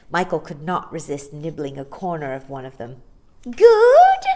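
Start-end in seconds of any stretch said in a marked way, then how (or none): 3.4-4.2 very high, loud squeak. A narrator's reading. Old shopkeeper squeaking delight as Michael nibbles. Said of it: "squeaked Missus Corey".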